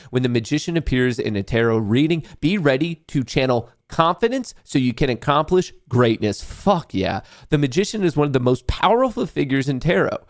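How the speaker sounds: usual speaking voice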